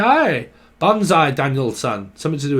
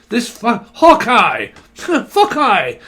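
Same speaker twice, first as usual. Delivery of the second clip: loud, drawn-out call of Hawkeye; excited shout; 7 semitones higher, 7 dB louder, much slower than usual. Real, not acted. Psychic blurting out a name she hears, excited.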